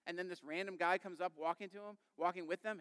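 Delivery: ordinary talk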